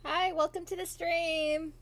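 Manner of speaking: usual speaking voice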